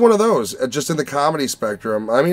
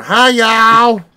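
mock Southern greeting, higher and louder; Southern accent; 8 semitones higher, 7 dB louder than usual. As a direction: put on a twangy Southern drawl for the cashier lady. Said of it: "Where the fuck did this accent come from".

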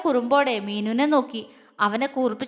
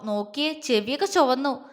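plain reading voice

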